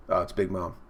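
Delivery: ordinary delivery, nothing marked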